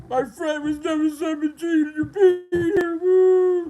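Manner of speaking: high, loud, wailing whine; hammed-up bawling complaint; mock crying. Beaten player's friend crying about it, mock wail. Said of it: "They're crying and shit".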